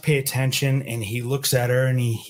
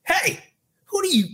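high, startled outburst of outrage; a mock shout of indignation, pitched way up; outraged yell. Bartender snapping at a quarterback who insulted her coworker, in a high outraged cry. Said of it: "I went".

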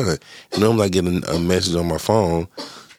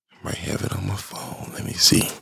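whispered under the breath; muttering to self; 3 semitones higher, 5 dB quieter than usual. Real, not acted. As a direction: mutter while checking the phone. Checking phone while talking.

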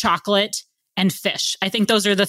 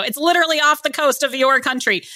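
mildly exasperated, higher-pitched comeback; incredulous retort; 7 semitones higher than usual. Retold or imitated. Guest retelling a rebuttal to Nigerians who doubt the country, incredulous. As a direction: push back in lightly acted disbelief.

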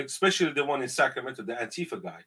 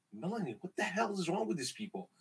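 hushed, much softer complaint; quiet aside; 5 semitones higher, 10 dB quieter than usual. Retold or imitated. To coworker after customers leave, hushed aside. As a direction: mutter it to her privately.

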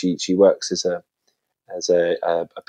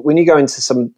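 normal talking voice

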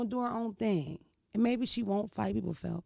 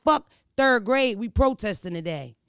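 mock protest shout; 2 semitones higher, 7 dB louder than usual. Kids' protest imitated.

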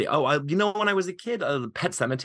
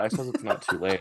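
normal talking voice

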